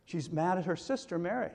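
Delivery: ordinary talk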